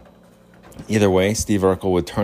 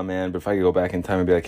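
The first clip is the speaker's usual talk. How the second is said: normal talking voice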